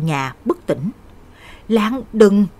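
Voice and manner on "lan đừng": loud warning cry in narration; loud shout. A narrator's reading. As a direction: shout to stop her. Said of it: "Tôi la lớn". (la lớn can ngăn)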